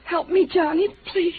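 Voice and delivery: desperate plea. Acted. Frightened wife begging for help.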